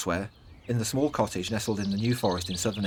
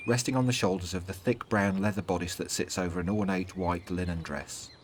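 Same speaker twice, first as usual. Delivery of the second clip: ordinary delivery, nothing marked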